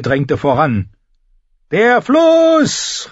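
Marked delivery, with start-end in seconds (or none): 1.7-3.1 high, loud battle cry over the noise; shouted call. A narrator's reading. Tyrion shouting to his tribesmen while riding, a loud call. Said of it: "rief er seinen Stammesbrüdern im Reiten zu".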